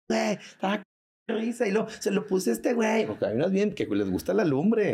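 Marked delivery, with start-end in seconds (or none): 0.1-3.1 higher, giggly woman's voice; mock gossiping woman. Comedian imitates a cheating woman bragging to friends, high voice. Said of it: "Va a decir".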